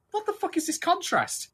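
high-pitched incredulous rant; exasperated disbelief. Retold or imitated. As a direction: voice jumps up in baffled outrage. Acts out own baffled reaction.

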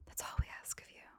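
hushed, whispered mock plea. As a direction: whisper it as a pointed request. Mock plea to visiting tourists.

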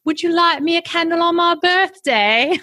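high-pitched, badly faked British accent. Shown as a demonstration. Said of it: "do it in a British accent".

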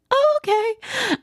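caught-off-guard oh okay; stunned okay. Retold or imitated. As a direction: let the surprise stretch the words out. Told to go to ER.

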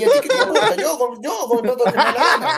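defiant about his own money; cheeky laughing retort; laughing defiance. Real, not acted.

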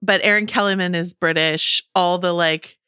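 usual speaking voice

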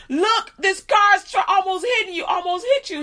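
high, loud, half-laughing alarm; high-pitched urgent exclaiming; frantic retold warning. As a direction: reenact alarm on the edge of laughter. Reenacting warning to the boy, laughing.

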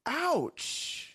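high, drawn-out mock ouch; retold ouch. Coach reacts to the inner voice's correction, high mock ouch. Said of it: "And I was like".

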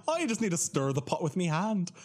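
high-pitched mock Irish accent; botched Scottish, sounds Irish. Storyteller failing at the receptionist's accent, coming out Irish. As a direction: attempt Scottish and slide into Irish. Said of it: "That's Irish".

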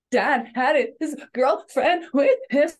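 put-on high, loud, sobbing child voice; child crying to a parent, high, loud and sobbing. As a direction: play a child coming home in tears. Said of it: "They come home sobbing hysterically".

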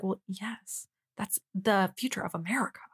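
a hushed, breathy mock of Pam through a cracked door; mock whisper. Pam answers the reporter at a cracked door, mock whisper. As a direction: whisper it like she's hiding behind the door. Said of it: "that's how she was acting".

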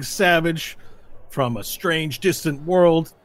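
high, loud showman's announcement; arena announcer. Acted. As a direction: hype the crowd for the grand final.

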